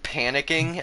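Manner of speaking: normal talking voice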